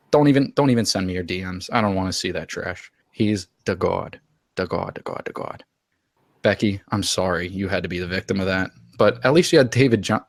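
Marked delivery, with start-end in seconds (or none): 3.1-5.6 reverent worship. Acted.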